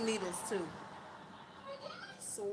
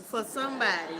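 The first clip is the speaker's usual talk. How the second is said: normal talking voice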